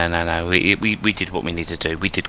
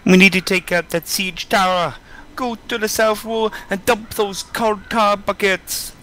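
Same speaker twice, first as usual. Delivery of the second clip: commanding NPC voice; 12 semitones higher than usual. Acted. Reading NPC orders aloud.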